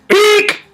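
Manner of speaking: very high squeaky eek; kitty's eek. Acted. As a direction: squeal eek like a startled cat. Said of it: "says the kitty".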